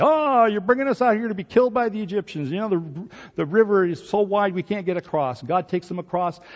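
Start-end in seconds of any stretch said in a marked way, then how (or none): 0.0-5.4 high, whiny complaining voice; mock the complainers with a whine; whining Israelites. Israelites complaining to Moses, high mocking whine. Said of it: "They whined and whined".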